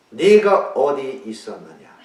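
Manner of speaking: accusing question. Shown as a demonstration. God accusing Adam in the wrong reading, loud and slow. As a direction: thunder "있었느냐" as a rebuke. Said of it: "이게 아니잖아요".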